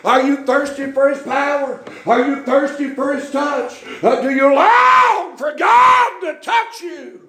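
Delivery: rising pulpit cadence, pushing harder each line; preaching. Acted. Preacher stirring the congregation, building fervor.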